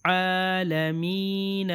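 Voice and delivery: عالمین drawn out very slowly; slow reading demo. Shown as a demonstration. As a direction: stretch عالمین with long vowels. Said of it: "یا مدہ بھی ہے".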